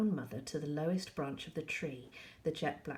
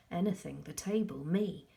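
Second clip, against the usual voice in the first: ordinary delivery, nothing marked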